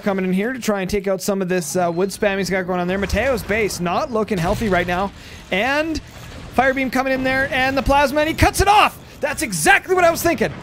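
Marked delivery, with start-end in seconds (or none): 5.5-10.5 high-pitched call building to a shout; excited play-by-play. Real, not acted. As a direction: erupt as the big play lands. Live call of decisive shot.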